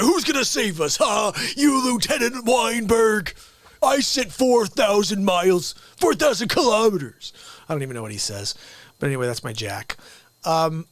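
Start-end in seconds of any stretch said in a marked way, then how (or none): 0.0-7.3 mock yell at Lieutenant Weinberg; mocking shout. Real, not acted.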